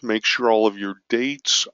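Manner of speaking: ordinary delivery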